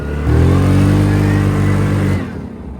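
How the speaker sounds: long, loud belly laugh; a big burst of real laughter; loud laughing fit. Real, not acted. Laughing at the absurd quad.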